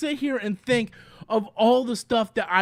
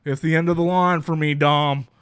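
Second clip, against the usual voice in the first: mock heroic sacrifice; 8 semitones lower than usual. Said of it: "John Cena's like".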